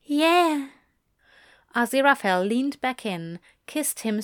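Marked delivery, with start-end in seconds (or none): 0.0-0.7 higher, louder character voice. A narrator's reading. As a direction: answer higher and brighter. Aziraphale voiced in the story.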